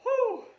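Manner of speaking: loud, high "whew" exhale; amused whew. Real, not acted. Vlogger tickled by a story too inappropriate to tell, big whew. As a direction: blow off the giggles with a high whew. Recovering from a hilarious memory.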